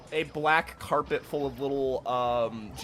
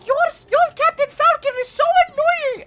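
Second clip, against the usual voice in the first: very high, loud whiny complaint; squeaky gamer NPC; 13 dB louder than usual. Acted. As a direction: whine like a sore loser at a game.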